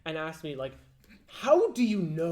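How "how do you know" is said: loud, high, drawn-out mock wonder; mock profound questioning. Mocking imagined earnest questioner.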